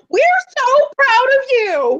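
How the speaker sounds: high, drawn-out gushing praise; proud cheering. Acted. Loyal fans answering the outraged crowd, gushing proud cheer. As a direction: cheer like his biggest fan.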